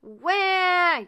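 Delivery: put-on very high, loud baby "Wah!"; a baby ghost's sudden cry; baby ghost wail. A narrator's reading. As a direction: let out a startled baby wail. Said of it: "yelled Baby Ghost".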